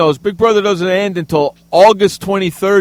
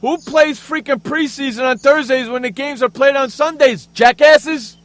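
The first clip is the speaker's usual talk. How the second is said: rising angry rant, yelled last word; 6 semitones higher, 4 dB louder than usual. Real, not acted. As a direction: rant louder and louder at them. Ranting at the league.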